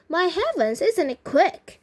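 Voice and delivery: lightly acted delighted surprise; enjoying it. A narrator's reading. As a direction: marvel with open relish. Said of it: "the only one enjoying it".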